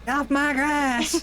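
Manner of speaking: put-on high, slow grumpy old man yell; shouting at trespasser. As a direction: shoo kids off your porch.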